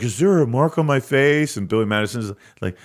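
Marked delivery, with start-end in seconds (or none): 0.0-1.6 slow, higher mock Chris Farley whine; Chris Farley impression. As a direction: play up the dazed comedian asking about his face. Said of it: "Chris Farley is like".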